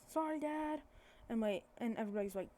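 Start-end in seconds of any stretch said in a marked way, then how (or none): 0.1-0.8 a light, contained call of apology; retold yell. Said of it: "he yells".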